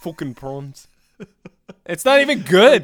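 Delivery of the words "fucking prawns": put-on South African accent. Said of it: "only South African accent I can do".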